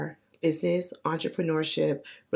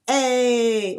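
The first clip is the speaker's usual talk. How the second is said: loud, higher hype call; full-voice hype; 5 semitones higher, 7 dB louder than usual. Pretend. Hot Girl Summer hype, loud and higher. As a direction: belt it like a party shout. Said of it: "for me to be like".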